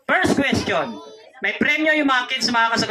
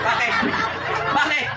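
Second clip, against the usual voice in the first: high squeak of laughing disbelief; laughing question; 9 semitones higher than usual. Real, not acted. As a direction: ask through a burst of laughter. Host laughing at the answer.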